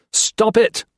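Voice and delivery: high, loud command; officer shouting at the colonel; shouted. A narrator's reading. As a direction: shout him down. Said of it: "Gray shouted".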